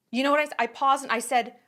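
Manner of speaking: ordinary delivery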